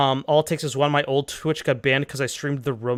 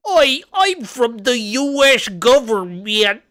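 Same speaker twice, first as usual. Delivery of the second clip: high, slow, loud mock American accent; hammed-up parody of a terrible US accent; atrocious US accent; 9 semitones higher, 6 dB louder, slower than usual. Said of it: "that is a atrocious U S accent".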